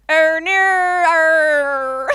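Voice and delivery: put-on very slow, high, loud "Oh, no, er" in an Australian accent. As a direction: drag every vowel out, broad Australian. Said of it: "like Australian".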